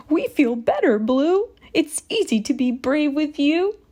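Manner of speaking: grateful goat, pitched high. A narrator's reading. Goat thanks Blue, high goat voice. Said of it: "Goat said".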